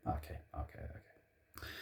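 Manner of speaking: barely breathed, resigned okay, okay, okay; whispered okays. Real, not acted. Fan sighing over club policy in a soft whisper. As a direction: mutter it away under your breath.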